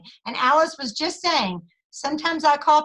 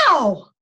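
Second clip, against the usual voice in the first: mock pain yelp; 3 semitones lower, 5 dB louder than usual. Patient touched, yelps again.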